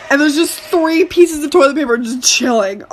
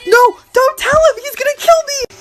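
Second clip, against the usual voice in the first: higher, panicked plea, slowed; freaking out; 8 semitones higher than usual. Retold or imitated. Friend begging them not to get the homeowner, panicked. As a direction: beg them not to tell, voice climbing. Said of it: "my friend starts freaking out".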